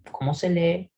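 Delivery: ordinary talk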